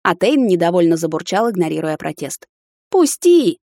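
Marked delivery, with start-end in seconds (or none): 2.9-3.6 high, loud yelp; protesting cry. A narrator's reading. Woman kicking over the hunter's shoulder, high loud protest. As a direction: demand release in a piercing shout. Struggling to break free.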